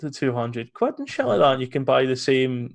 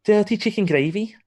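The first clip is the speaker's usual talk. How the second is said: Scouse accent, pitched up and punchy; playful Scouse accent on the gravy line; 8 semitones higher than usual. Shown as a demonstration. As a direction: say it like a Liverpudlian. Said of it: "in a Scouse accent".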